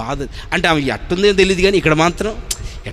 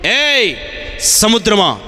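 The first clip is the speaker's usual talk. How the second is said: commanding the storm; 3 semitones higher, slower than usual. Acted. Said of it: "అంటాడు".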